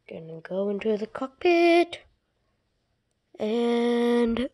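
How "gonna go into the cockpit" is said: ordinary delivery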